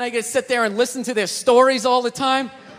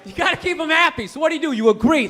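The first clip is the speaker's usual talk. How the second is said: normal talking voice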